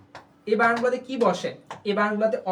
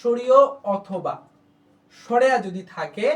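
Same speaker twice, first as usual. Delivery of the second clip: slow demonstration; 4 dB louder, slower than usual. Shown as a demonstration. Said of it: "আমি একটু বাড়াই দিই নিয়মটা".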